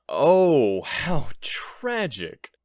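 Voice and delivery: high, slow hammed-up how tragic; theatrical sigh of fake grief; mock tragic. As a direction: overplay the sorrow so it sounds fake. Mock lament over the empty bag.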